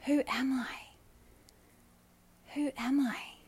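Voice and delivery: usual speaking voice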